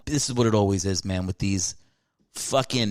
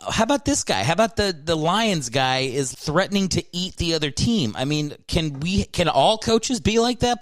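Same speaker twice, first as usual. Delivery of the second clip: excited fan pleading, much higher; 7 semitones higher than usual. Real, not acted. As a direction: pitch climbing high with eager delight. Excited plea for wild coaches.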